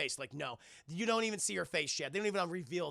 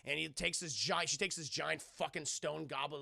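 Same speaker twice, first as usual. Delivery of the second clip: normal talking voice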